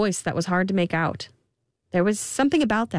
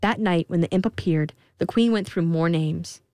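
usual speaking voice

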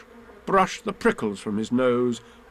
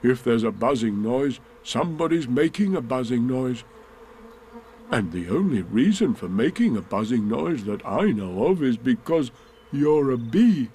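lightly voiced pondering bear. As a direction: puzzle it out as a thoughtful bear.

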